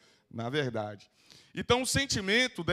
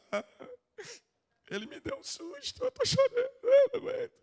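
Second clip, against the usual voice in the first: mock crybaby whine. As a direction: play the fragile crier who can't take a scare. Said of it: "e começa". (choro exagerado e agudo, de brincadeira)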